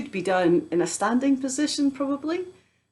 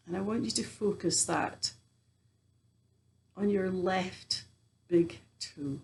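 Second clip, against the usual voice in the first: guided meditation; 4 dB quieter than usual. Acted. Teacher guiding listeners through a body scan, guided meditation. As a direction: ease them gently through the body, pausing often.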